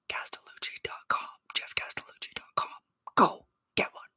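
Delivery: hushed, conspiratorial whisper; whispered tip. Retold or imitated. Fan tipping off coworkers where to buy the shirt, whispered. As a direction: whisper it like a secret plug. Slyly passing on the web address.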